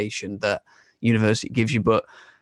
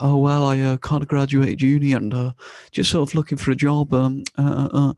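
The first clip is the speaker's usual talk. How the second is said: higher and louder, flustered and stumbling; awkward fumbling; 4 semitones higher, 4 dB louder than usual. Retold or imitated. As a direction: bluster through an embarrassed excuse. Awkward answer about graduating uni.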